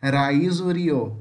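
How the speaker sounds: Raizo, rio with a tongue-tapped first R; teacher repeating tapped R in Raizo, rio; tapped R demo. Shown as a demonstration. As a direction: start Raizo and rio on one light tap, not an H. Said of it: "Esse é o som do R".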